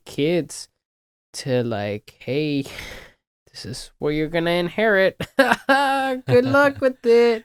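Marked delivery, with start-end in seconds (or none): 2.2-7.4 laughing, higher-pitched mock good wishes; joking mock send-off. Speaker pretends to hand the world to kids, laughing mock send-off. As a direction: joke through laughter, pitch climbing into good luck.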